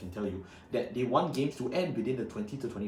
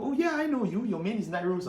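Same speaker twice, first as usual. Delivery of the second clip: a hint of a chirpy fan, higher; imitating a fan; 7 semitones higher than usual. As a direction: lightly mimic an eager fan spotting him. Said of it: "approached by people at events and go".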